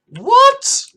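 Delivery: very high, loud squeak of disbelief; real stunned outburst; astonished what. Real, not acted. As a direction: shriek it in shock. Disbelief at secure mode.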